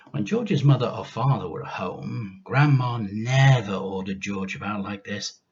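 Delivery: ordinary delivery, nothing marked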